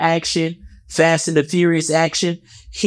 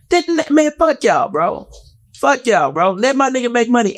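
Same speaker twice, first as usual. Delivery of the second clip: indignant; 8 semitones higher than usual. Real, not acted. Defending comedian against critics.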